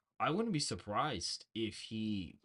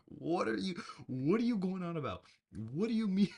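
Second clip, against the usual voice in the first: incredulous; 7 semitones higher than usual. Retold or imitated. Retelling her baffled reaction.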